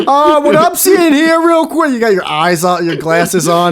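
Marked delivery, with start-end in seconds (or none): 0.1-1.9 loud, high, slow hammed-up briefing shout; mock reporter voice. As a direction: play up an official giving a report.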